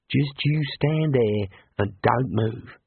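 slow Australian bushman voice; mysterious bush mentor. A narrator's reading. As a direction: instruct slowly as the unseen mate of the bush. Unseen bush voice gives instruction.

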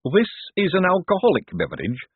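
high, nervous voice; timid and high-pitched. A narrator's reading. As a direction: query it anxiously, pitched well up. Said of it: "said Hubert nervously".

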